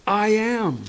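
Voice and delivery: Jesus answers the crowd firmly; firm declaration. Retold or imitated. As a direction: announce it boldly. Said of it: "he just simply responds to them".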